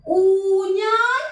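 ഊഞ്ഞാൽ stretched very slowly, high and loud, long ഊ; drawn-out model word. Shown as a demonstration. Teacher to class, modeling the word, slow and stretched. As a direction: draw out ഊഞ്ഞാൽ slowly for the children to copy. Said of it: "ഒന്ന് പറഞ്ഞേ".

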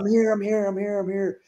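high, hurried I'm here; breathless calling out. Retold or imitated. Late passenger calling to the crew, high and hurried. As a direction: call out as you rush aboard. Running onto the plane late.

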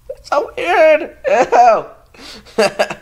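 high, drawn-out squeal of disbelief; grossed-out squeal. Real, not acted. Vlogger reacting to a growling stomach, high amused squeal.

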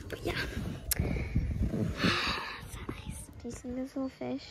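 relaxed whisper with a sigh. Real, not acted. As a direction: breathe it out, content. Relaxing on the boat.

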